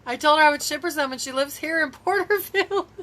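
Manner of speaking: high, loud, laughing through words; amused telling. Real, not acted. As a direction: break into laughter as you tell it. Laughing at her own mix-up.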